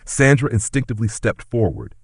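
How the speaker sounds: ordinary delivery, nothing marked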